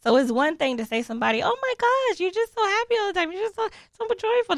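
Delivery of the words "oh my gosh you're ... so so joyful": much higher, thrilled praise; delighted compliment; gushing compliment. Retold or imitated. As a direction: gush like a fan. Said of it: "that's like a compliment".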